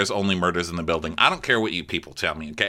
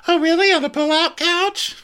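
loud, very high sceptical imitation; mock squeal; 5 dB louder than usual. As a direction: squeal the question in caricature. Voices Brandy doubting the bed.

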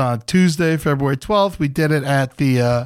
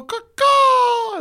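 the crowd's answer, crowed like a crow; very high crow cry for a call and response. Retold or imitated. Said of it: "y'all go".

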